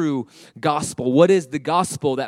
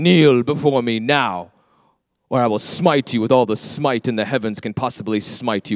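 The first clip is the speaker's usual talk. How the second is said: booming demon lord; 4 semitones lower than usual. Retold or imitated. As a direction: threaten like a wrathful sky tyrant. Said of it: "that's how we think God is".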